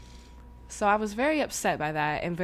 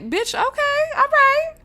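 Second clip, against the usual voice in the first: high, loud hammed-up threat; mock aggressive shout; 11 dB louder, slower than usual. Officer with hand on hip confronting her, loud mock threat. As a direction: imitate him squaring up, rising high and loud.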